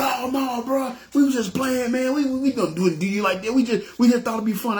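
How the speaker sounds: high, mock-panicked protest of a caught robber; imitating a scared thug insisting it was a joke; panicky denial. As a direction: pitch it way up like a guy backpedaling fast.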